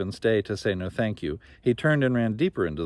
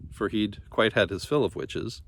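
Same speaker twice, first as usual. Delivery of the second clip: plain reading voice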